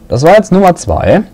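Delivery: ordinary talk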